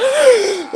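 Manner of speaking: loud high gasp for air after holding breath; big recovery gasp; desperate gasp. Pretend. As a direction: burst up for air. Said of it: "I can hold upon my breath".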